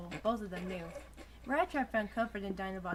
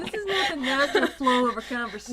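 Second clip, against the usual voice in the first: laughing outburst; 7 semitones higher, 20 dB louder than usual. Real, not acted.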